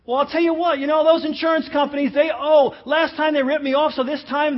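loud, high mock protest; hammed-up, blustering self-justification; indignant objection. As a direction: play an aggrieved customer arguing back. Voices an aggrieved objector cut off.

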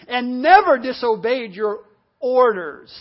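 loud, slow, emphatic grievance; self-righteous complaint. Acted. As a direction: punch "never" and "orders". Quotes the self-righteous brother.

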